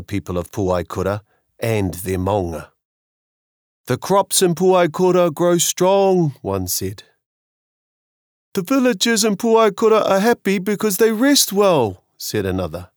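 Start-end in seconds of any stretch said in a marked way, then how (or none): 3.9-6.3 envious islander. Acted. Villagers quoted in story. 8.5-12.0 high, lilting envious villager; envious islander. Acted. Villagers quoted in story.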